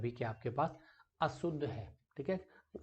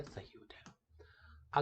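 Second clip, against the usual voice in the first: whisper; 3 semitones lower, 6 dB quieter, much slower than usual. Real, not acted. Teacher confirms answer.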